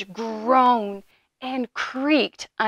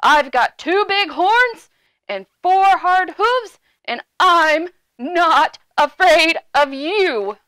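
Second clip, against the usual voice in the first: loud, raised, defiant big goat; 5 semitones higher, 5 dB louder than usual. A narrator's reading. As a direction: stand up to the troll, booming. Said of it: "in his big voice".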